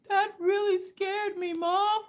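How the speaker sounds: very high, slow mock wail; imitating crying son. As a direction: whimper like a frightened child, hammed up. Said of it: "And he starts crying".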